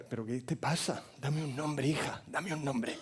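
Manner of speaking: soft, restrained questioning; hushed father. Retold or imitated. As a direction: coax it out of her quietly. Father gently questions crying daughter.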